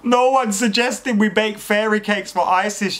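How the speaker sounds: high-pitched exasperated retort. Real, not acted.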